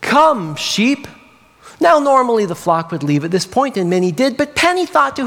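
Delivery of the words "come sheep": raised, lilting shepherd's call. A narrator's reading. Shepherd summoning his sheep at day's end, calling out. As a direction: call the flock in from across the field. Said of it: "the shepherd called out with words".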